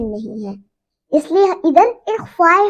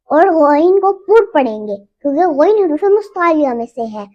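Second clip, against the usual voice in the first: normal talking voice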